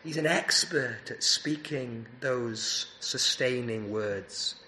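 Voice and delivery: rising, emphatic sermon voice with pushes on key words; fervent preaching. Acted. Preacher urging his congregation to go to Jesus, fervent preaching. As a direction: preach it to the weary, building and falling back.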